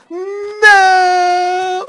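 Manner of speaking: long, high, loud wail of no; panicked cry. Real, not acted. Player loses the unicorn, panicked high cry. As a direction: cry out in drawn-out dismay.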